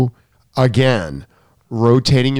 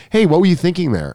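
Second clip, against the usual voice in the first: higher-pitched but casual, gentle challenge; restrained reproach; 6 semitones higher, faster than usual. Retold or imitated. Coach showing how he really asked the student, restrained. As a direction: ask it lightly, without yelling. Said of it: "I didn't say it like that".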